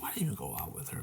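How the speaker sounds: quick, hushed self-reproach; rapid whispered muttering to oneself; retold whispered regret. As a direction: question yourself under your breath. Retold private regret after failed date.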